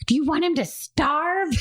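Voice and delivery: put-on dramatic child.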